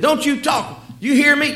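high, loud scolding; stern parent scolding a child, high and loud; angry parent. Retold or imitated. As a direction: bark at the child. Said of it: "She's the kind of parent that".

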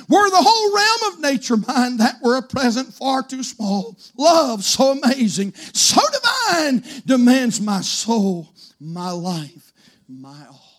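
slow, swelling then dropping to near silence; hymn fading to hush. Acted. Preacher closing the hymn quote, fading to a hush. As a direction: let the last words vanish.

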